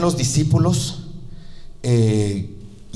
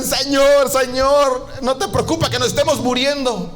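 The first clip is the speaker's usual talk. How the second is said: frantic plea; 10 semitones higher than usual. Acted. Disciples wake Jesus urgently.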